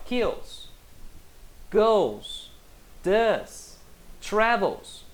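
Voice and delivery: "Kills, goes, does, travels" spaced out, each ending in a clear s; high falling tune on "Kills", "goes", "does", "travels", sounding the s; final S sound. Shown as a demonstration. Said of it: "trazer sempre o som de S".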